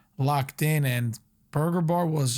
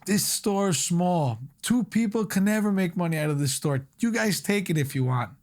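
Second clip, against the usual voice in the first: imitating a Boston accent, a bit higher, dropping the R's; 5 semitones higher than usual. Partner Richie pitching the small store to the boys, in a Boston accent. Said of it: "he has that, like, Boston accent".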